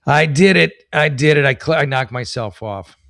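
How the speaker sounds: loud outburst at your own mistake; real loud exclamation. Real, not acted. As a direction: burst out loudly, owning the blunder. Knocked himself off the stream.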